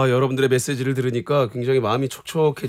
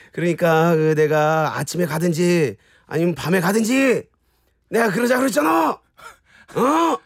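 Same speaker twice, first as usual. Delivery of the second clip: irritated outburst. Acted. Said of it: "역시 짜증을 내야 확실히 더 사네요".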